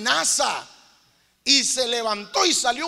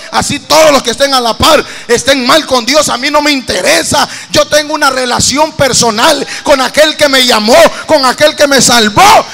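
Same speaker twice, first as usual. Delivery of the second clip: much louder, fervent preaching at the top of the voice; full-force preaching; 17 dB louder than usual. Acted. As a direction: thunder it out to the congregation.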